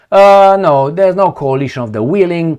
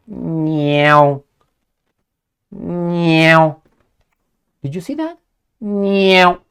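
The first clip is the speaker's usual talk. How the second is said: cat meow; 2 semitones higher, much slower than usual. Pretend. Imitating a cat.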